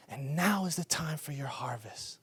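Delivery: hushed, breathy, secret-sharing; whispered prophecy. Retold or imitated. Hospital woman delivering a prophetic word, whispered. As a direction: let the promise fall to a whisper.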